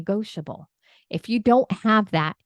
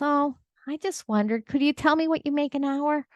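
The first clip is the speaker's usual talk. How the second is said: higher-pitched imitation of an innocent child; little boy; 8 semitones higher than usual. Little boy asking his dad about pay, high child voice. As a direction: play a small boy asking sheepishly, pitched up.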